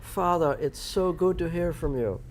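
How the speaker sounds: hammed-up formal son greeting father; mock posh, slow and proper. Son answering his dad's call, mock formal and proper. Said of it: "Father, Father".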